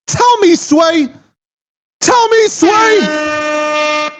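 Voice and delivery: stretched, shouted Tell me, Sway; mock demand shout. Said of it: "catchphrase shout".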